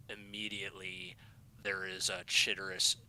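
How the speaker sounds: ordinary talk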